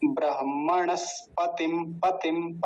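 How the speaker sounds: पतिम with a short quick इ; teaching demo; careful pronunciation. Shown as a demonstration. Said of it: "तकार पर छोटी ई की मात्रा".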